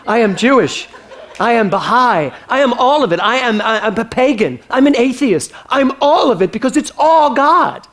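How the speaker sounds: loud, high, impassioned climax; rousing declaration. Real, not acted. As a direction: proclaim each identity with rising fervour. Climax of preacher's list of faiths.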